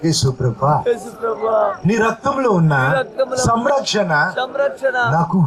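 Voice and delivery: preacher leading prayer aloud, phrase by phrase; fervent prayer. Acted. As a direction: pour it out to God with the church. Leads congregation in prayer. (ప్రార్థనలో ఉత్సాహంగా)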